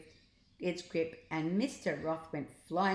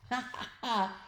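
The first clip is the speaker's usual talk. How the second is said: giggling; 5 semitones higher than usual. A narrator's reading. Teachers giggle.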